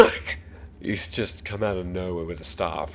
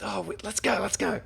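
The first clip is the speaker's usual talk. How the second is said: much higher, urgent call to his friends; yelling to his group; 14 semitones higher, 3 dB louder than usual. Acted. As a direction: shout for the group to get moving. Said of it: "just yells towards the other group".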